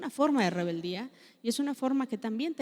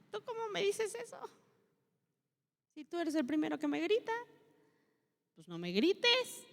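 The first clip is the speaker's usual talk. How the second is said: higher mocking teen retort, slowing at the end; talking back; 9 semitones higher than usual. Grown child talking back to yelling parent, mock indignant. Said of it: "llega un momento que dices".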